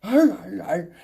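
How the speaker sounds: short mock dog barks; dog snarling. Police dog yoked up on its leash, snarling. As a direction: imitate a restrained dog straining to attack. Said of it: "'cause the dog is like".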